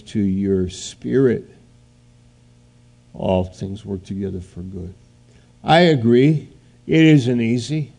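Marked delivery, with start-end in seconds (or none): 3.1-4.9 gentle. Acted. God speaking to your spirit, soft and reassuring. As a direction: let "All things work together for good" land quietly and sincerely.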